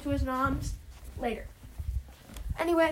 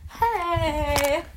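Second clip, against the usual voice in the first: drawn-out put-on greeting; bodyguard. Derek standing guard in the doorway, bodyguard voice. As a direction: stretch out a bodyguard's hey. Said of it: "Sorta like bodyguards".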